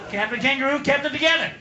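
ordinary talk, nothing marked

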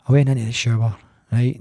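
usual speaking voice

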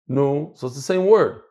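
ordinary delivery

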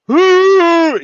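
high, loud imitated drunk whoo; walrus-like whoo. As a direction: howl it out like a walrus. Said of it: "That's exactly how he sounded".

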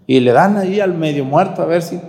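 usual speaking voice

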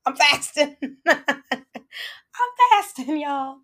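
high, drawn-out laugh through the words. Real, not acted. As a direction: giggle it out at her own stumbling. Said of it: "I haven't eaten or drinking all day".

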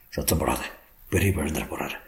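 low, soft warning; hushing. Acted. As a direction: hush her, voice dropped low. Husband hushes crying wife. (தாழ்ந்த குரலில் அடக்குதல்)

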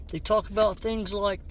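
ordinary talk

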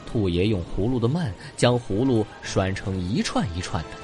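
usual speaking voice

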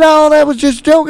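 high, loud mimicked friends; retold dismissive protest.

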